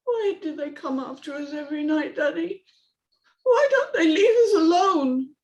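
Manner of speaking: put-on high child's voice rising in fear; frightened little girl. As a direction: dread as the siren wails. Amy's voice.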